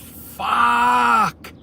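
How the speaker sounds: high, drawn-out mock scream; re-enacted scream of shock; imitated scream. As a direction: mimic a shocked shriek, stretching the word. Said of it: "she jumps up and she screams".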